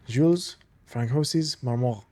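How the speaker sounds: names said in a light French accent, Jules as zhule; a hint of a French accent reading names. Shown as a demonstration. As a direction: roll Jules Francois Marmont the French way. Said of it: "saying these names in this accent".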